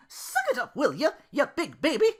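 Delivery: high, loud snap; sharp scold to a crybaby partner; snapping Belle. Acted. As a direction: snap at him impatiently. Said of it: "snapped Belle".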